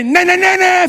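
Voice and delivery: high, loud taunting chant; mocking teasing. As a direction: tease him like a kid. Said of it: "fiquei no telefone zoando ele". (zombando, alto e agudo)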